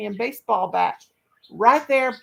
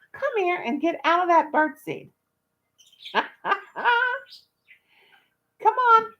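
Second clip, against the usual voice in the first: higher coaxing call to a pet; calling the dog; 10 semitones higher than usual. Real, not acted. Owner coaxing her dog out of the birdseed, high coaxing call. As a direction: coax the dog out, pitched up.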